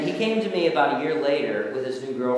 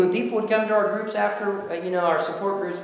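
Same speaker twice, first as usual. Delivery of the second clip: ordinary talk, nothing marked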